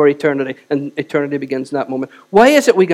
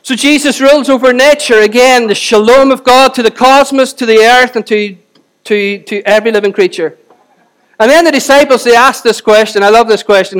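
loud, higher, fervent preacher's voice; preaching at full force; 5 semitones higher, 8 dB louder than usual. Acted. Preacher marvelling at the disciples' question, preaching at full force. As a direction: proclaim it to the back row.

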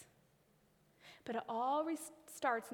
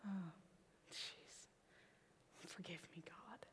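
soft, whispered confession; sheepish whisper to God; whispered apology; 6 semitones lower, 17 dB quieter than usual. Retold or imitated. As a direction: barely breathe the apology. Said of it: "and I was like".

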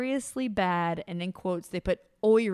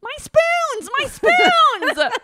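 very high, loud wail over her spoons; mocking the heroine clutching her spoons; wailing snob; 11 dB louder, much slower than usual. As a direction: cry for the spoons like a spoiled lady. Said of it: "she's just like".

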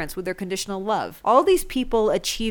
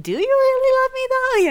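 put-on high, far-off childlike voice; a small kid asking, pitched way up; doubtful kid; 4 dB louder than usual. Kid asking conditional parents, high and doubtful. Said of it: "And you're like".

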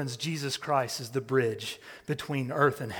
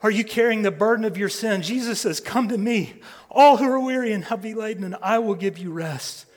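faster, higher, louder appeal; fast, raised altar call; urgent preaching; 7 semitones higher, 9 dB louder than usual. Real, not acted. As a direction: press the invitation with urgency.

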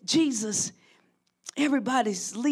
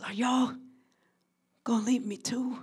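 whisper, softer and hurt; whispered dread; 8 dB quieter than usual. Retold or imitated. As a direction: whisper the fear of betrayal. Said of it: "I feel the dread of betrayal".

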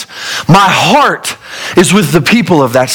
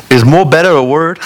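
ordinary talk

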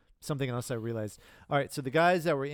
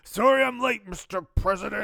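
pilot quote; 8 semitones higher, 5 dB louder than usual. Acted. Quoting the drunk pilot character.

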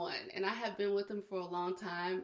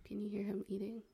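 ordinary talk, nothing marked

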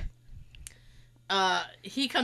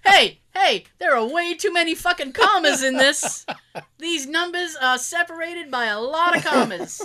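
loud, raised-pitch comic protest; mock outrage; 5 semitones higher, 7 dB louder than usual. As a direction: protest loudly, hammed-up indignation at the bill. Mock outrage at dental price.

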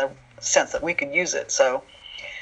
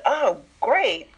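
higher-pitched, delighted "oh"; acted-out enthusiasm for the news; pleased reaction; 4 semitones higher than usual. Writer hears of a new Misfit, pleased.

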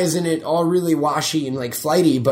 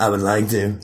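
put-on voice; 5 semitones lower than usual. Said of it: "you gonna do the whole thing like that?".

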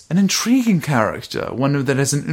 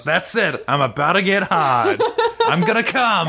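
laughing, rising mock excitement; 6 semitones higher than usual. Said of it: "And he goes".

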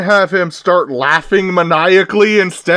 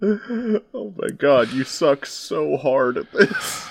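laughing softly; 4 semitones lower, 8 dB quieter, slower than usual. Real, not acted. Laughing at the villain.

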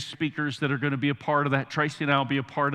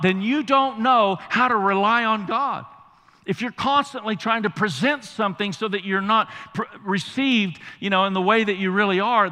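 loud, high-pitched preaching; preaching at full force; 7 semitones higher, 5 dB louder than usual. Acted. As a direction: preach it with fire. Opening of sermon at full force.

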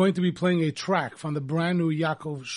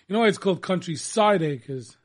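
ordinary delivery

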